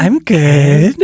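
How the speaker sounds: ghost answer through a laugh. Retold or imitated. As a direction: giggle out the ghost's reply.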